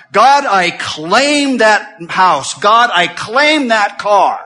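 slow loud mock demand, imitating a name-it-claim-it believer. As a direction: ham up the greedy claim, drawn out. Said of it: "that lie has spread".

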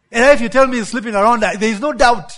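loud, fast, dead certain gossip; gossip certainty. Acted. Gossip voiced in sermon.

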